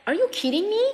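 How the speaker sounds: high-pitched, disbelieving retold comeback; incredulous retort. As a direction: throw back a high, incredulous question. Retelling her incredulous comeback in quarrel.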